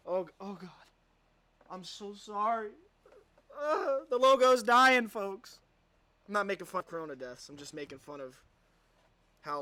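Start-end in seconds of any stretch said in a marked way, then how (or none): 0.1-2.7 hammed-up fake sob on the words; mock crying. Mock weeping over logo. 3.6-5.6 louder, slow fake wail; hammed-up sobbing. Mock grief over logo.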